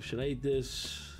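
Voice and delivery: hungry late-night self. Retold or imitated. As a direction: mumble to yourself at the fridge. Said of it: "robots like me at two A M".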